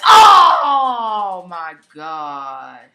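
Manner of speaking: low, slow, loud stretched oh my gosh; stunned, slowly dragged shout; drawn-out shout. Real, not acted. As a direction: groan it out long and loud. Inverted RKO suplex.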